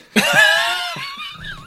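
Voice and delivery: very high screaming laugh; shrieking laugh. Real, not acted.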